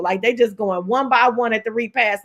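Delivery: ordinary delivery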